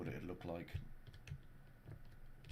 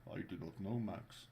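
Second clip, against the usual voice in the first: lower, softer Scandinavian voice; low Scandinavian accent; 2 semitones lower, 5 dB quieter than usual. Pretend. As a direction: shrug it in a Nordic lilt. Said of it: "that's why I'm doing the Scandinavian accent".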